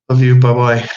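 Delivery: usual speaking voice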